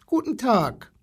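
put-on high little-boy hello; small boy's greeting. A narrator's reading. Small boy greets the zoo animals.

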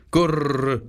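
short cooing „Gurr" like a wood pigeon. Acted. Wood pigeons ending their tale to Gerda, cooing. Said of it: "Da sagten die Waldtauben".

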